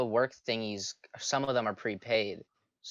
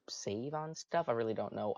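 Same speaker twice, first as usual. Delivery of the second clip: usual speaking voice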